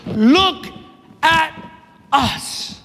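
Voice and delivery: LOOK, AT, US as three separate beats; weighty pauses between Look, at and us; apostle's command. Acted. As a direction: break "Look at us" word by word, falling away on US. Preacher voices the apostle's command.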